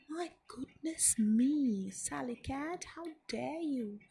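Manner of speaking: put-on storybook boy shouting at the cat. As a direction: scold the thieving cat in a hammed-up outburst. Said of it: "he shouted".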